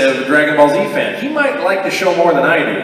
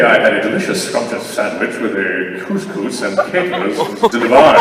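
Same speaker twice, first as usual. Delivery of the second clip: imitating Jay; 5 semitones lower than usual. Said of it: "because he talks like this".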